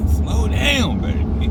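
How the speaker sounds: slow, laughing mock warning; playful slow scolding; teasing drawl.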